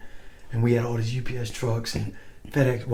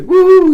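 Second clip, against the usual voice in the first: very high, loud mock whoop; whooping yell; 16 dB louder than usual. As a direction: imitate the guys whooping down the hallway. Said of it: "you know, yelling".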